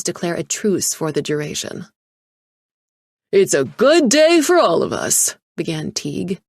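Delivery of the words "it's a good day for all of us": loud and high, smiling through the words; winning smile. A narrator's reading. Teague greets the criminal bidders, smiling broadly. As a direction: open with a salesman's beaming charm. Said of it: "smiling his winning smile".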